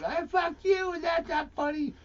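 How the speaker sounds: high mock woman's voice; mocking heckler. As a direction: squeal it like the angry heckler.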